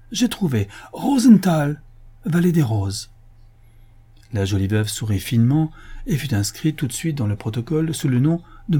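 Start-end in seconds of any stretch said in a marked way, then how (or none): 0.1-3.1 high, triumphant announcement; delighted discovery. Acted. Commissioner finding the perfect name for the widow, delighted. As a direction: proclaim your lucky find.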